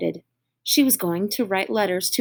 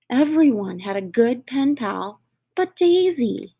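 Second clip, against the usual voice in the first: ordinary delivery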